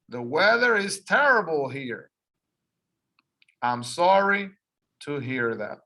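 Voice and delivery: clear, lively model reading of a phone call; teacher modelling a phone call; dialogue read for repeating. Shown as a demonstration. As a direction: read both parts for learners to copy. Reads phone dialogue aloud.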